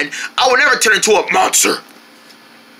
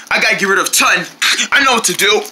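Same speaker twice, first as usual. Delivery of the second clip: ordinary talk, nothing marked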